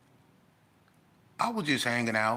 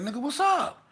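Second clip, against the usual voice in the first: urgent call; 6 dB louder than usual. Retold or imitated. Homeboy checking on him.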